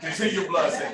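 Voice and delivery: mock Jamaican blessing, laughing; Jamaican accent. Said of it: "which is my terrible accent, Jamaican accent".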